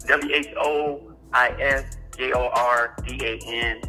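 ordinary talk

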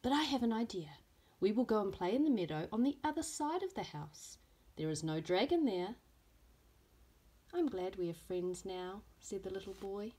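lively storybook reading with voiced characters; reading to children. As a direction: read it like story time for little ones. Teacher reads picture book aloud.

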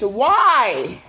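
ordinary talk

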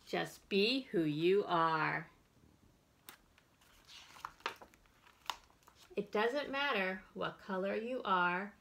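bright, expressive picture-book reading; reading to children. Acted. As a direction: read it out to a circle of little kids. Librarian reads picture book aloud.

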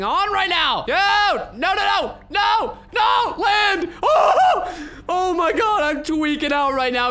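very high, loud yelps; panicked shouting. Real, not acted. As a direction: scream through a near disaster. Panics while falling in game.

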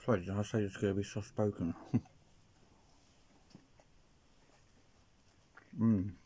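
chewing while talking; speaking between bites; mouth full. Real, not acted. Seen 1: holds pizza slice, still chewing since bite before speaking.

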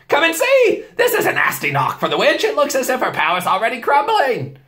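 high, loud gleeful beaver; crowing with delight; delighted shouting. A narrator's reading. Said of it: "almost dancing with delight".

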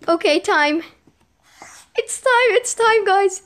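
high, loud excited squeal. Real, not acted. The final moment arrives.

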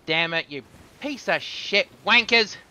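loud, high-pitched angry cursing; exasperated outburst. Real, not acted. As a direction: explode in real frustration at the game. Cursing at being shot in game.

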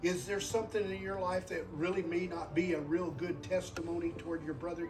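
usual speaking voice

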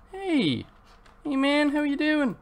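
high-pitched drawn-out cooing hello; greeting a pet. Real, not acted. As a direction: greet your parrot in a high little voice. Greets his parrot in the game.

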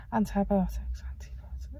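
"antibiotics" whispered under the breath, unvoiced; whispered practice. Real, not acted. Vlogger rehearsing a word she can't say, whispering. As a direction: mouth "antibiotics" silently to get it right.

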